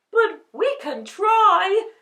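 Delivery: high, loud hopeful lion. A narrator's reading. Lion encourages friends, high and bold. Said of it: "said the lion".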